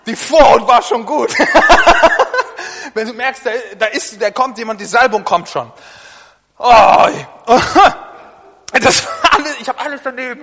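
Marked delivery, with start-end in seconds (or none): 1.4-2.9 loud high laughter. Real, not acted. Laughing at own Vorhaut slip. 7.1-8.3 loud laughing shout. Real, not acted. Laughing after eggs fell.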